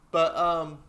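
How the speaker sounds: ordinary delivery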